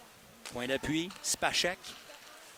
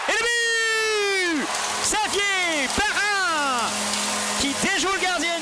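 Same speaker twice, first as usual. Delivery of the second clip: goal call; 2 semitones higher than usual. Real, not acted. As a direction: shout the goal, stretch the name. Goal call in hockey play-by-play.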